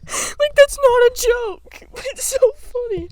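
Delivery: high, squeaky helpless laughter; laughing hard. Real, not acted. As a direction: protest while cracking up. Cracking up at non-jokes.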